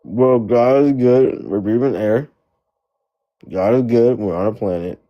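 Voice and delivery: usual speaking voice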